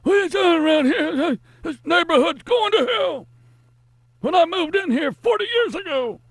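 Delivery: put-on high-pitched cranky old man rant; angry old man voice. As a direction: yell like a crank chasing kids off his lawn. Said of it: "the angry old man screaming at the kids".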